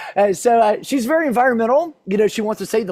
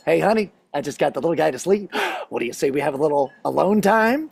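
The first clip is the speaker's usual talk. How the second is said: lowered voice, sweet-talking a spouse; coaxing husband; 5 semitones lower than usual. Retold or imitated. Husband asking wife for alone time, coaxing. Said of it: "and go".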